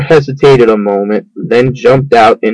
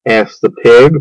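ordinary delivery

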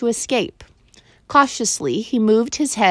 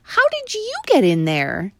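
higher, amazed question; surprised boy; 10 semitones higher than usual. A narrator's reading. Boy asking the mouse, amazed. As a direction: ask in wonder. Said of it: "obviously very much surprised".

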